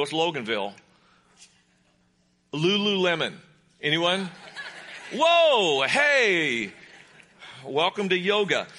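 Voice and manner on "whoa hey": loud, high, drawn-out whoa; surprised whoa. Real, not acted. Preacher hears yoga-brand fans cheer, surprised. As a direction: react loudly to an unexpected cheer. Mock surprise at audience response.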